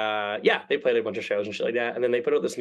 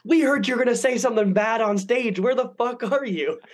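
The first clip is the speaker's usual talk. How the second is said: mock yell; 9 semitones higher than usual. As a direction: act out your friend hounding you to get there. Friends calling nonstop, pressed.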